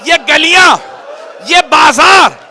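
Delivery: loud, high, wailing preacher's lament; rising grief-stricken sermon, loud and slow; majlis recitation at full force. Acted. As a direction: cry the lament out to the mourners.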